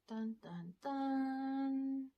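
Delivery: sung dun dun dun; mock suspense music hum; dramatic sting. Dramatic sting after tagline.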